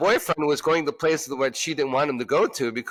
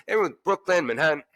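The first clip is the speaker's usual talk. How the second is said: normal talking voice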